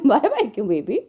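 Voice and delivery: usual speaking voice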